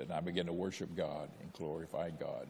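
ordinary delivery

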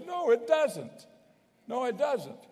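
flatly refute it, pitching up high on the "no"; preacher's insistent contradiction; emphatic denial. Real, not acted. Rebutting an imagined objector's claim.

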